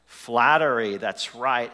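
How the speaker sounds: usual speaking voice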